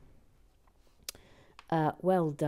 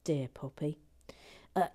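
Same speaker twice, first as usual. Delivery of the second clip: normal talking voice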